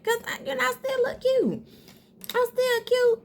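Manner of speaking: high playful squeal of I'm still cute; squeaky cute voice. Real, not acted. To her viewers about looking cute without makeup, squeaky high brag. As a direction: pitch it way up like a cute little brag.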